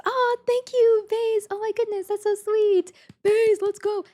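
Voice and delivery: very high, gushing thanks; excited squeal. Real, not acted. Streamer thanking a viewer for a gift, excited squeal. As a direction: squeal your thanks, drawing out the first words.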